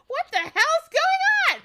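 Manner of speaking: very high, loud laughing shriek; shrieking princess. Acted. Princess shrieking at her captor, very high and loud.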